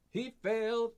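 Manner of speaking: thrilled the roll failed; excited. Real, not acted.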